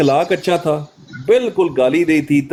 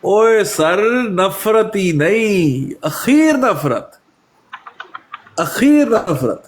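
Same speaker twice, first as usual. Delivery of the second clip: slow, higher-pitched, stretched words; long, raised, emphatic delivery; drawn-out emphasis; 3 semitones higher, much slower than usual. Real, not acted. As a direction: drag out each word for emphasis. Emphatic answer to the guest's point.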